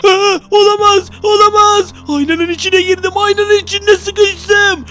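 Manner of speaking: trapped gamer wailing in panic; panicked wail. Real, not acted. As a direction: wail that you're stuck.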